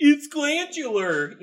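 high, slow, hammed-up excuse voice; mock put-on excuse. Joking excuse for the mustache in a silly voice.

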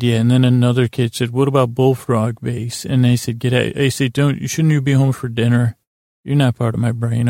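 ordinary talk

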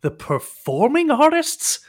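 high, loud, drawn-out excited question; light up as you suddenly recognize them; delighted recognition. Acted. Captive recognizes the famous troupe, delighted and amazed. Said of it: "his face lights up".

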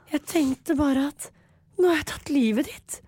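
high, slow put-on voice of a weepy girl; mock tearful actress. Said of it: "men hun sier sånn". (høy, langsom, overspilt gråtkvalt skuespillerstemme)

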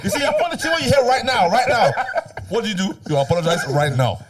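high, angry mock Irish accent, laughing through it; Irish accent telling-off. Mimicking an Irish girl demanding an apology on Clubhouse, angry Irish accent. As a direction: scold in a put-on Irish accent. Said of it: "when a girl's giving out in an accent".

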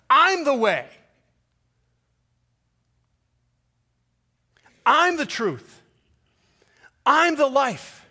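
loud, high, slow declaration; proclaiming loudly. Acted. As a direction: announce each line like a banner. Jesus's declaration voiced by preacher.

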